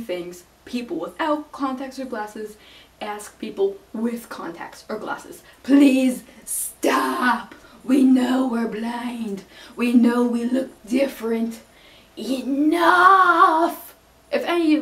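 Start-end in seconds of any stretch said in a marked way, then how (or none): 5.6-14.8 loud, fed-up outburst; exasperated shouting. Real, not acted. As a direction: yell it like you've had enough. Fed-up rant at annoying questions.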